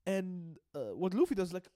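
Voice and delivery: ordinary talk, nothing marked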